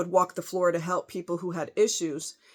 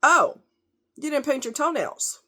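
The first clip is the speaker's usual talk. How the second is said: mock coworker remark; 8 semitones higher, 4 dB louder than usual. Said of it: "And he goes".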